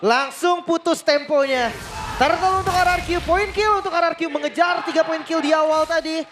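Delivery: high, keyed-up caster call; excited. Real, not acted. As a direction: lift the voice for the big play.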